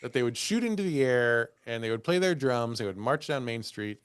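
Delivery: normal talking voice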